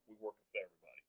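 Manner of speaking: normal talking voice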